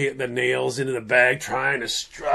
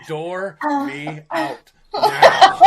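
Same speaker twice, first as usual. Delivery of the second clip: slow urgent plea; 10 semitones higher, 5 dB louder, much slower than usual. Retold or imitated. Retelling urgent need to flee.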